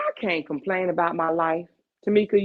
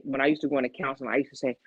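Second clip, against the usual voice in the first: ordinary talk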